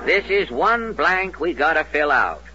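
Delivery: ordinary talk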